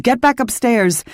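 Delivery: high, pressing order; urgent shout. A narrator's reading. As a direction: send her away at full voice. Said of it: "I shouted".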